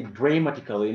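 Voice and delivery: "dramatically" with DRA said as "dray"; long "ay" in the first syllable of "dramatically"; mispronounced. Shown as a demonstration. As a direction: say "dramatically" wrongly as "dray-matically". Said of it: "Normally, it is not like that".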